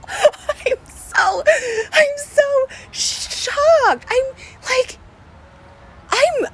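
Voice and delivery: high, cracking voice through tears, very slow on shocked; crying in shock. Real, not acted. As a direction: cry through the words, stunned. Shocked by friend's betrayal.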